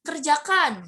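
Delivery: firm warning "Kerjakan"; firm exclamatory "Kerjakan"; command tone. Shown as a demonstration. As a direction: order it as a warning. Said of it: "tanda seru lebih seperti peringatan".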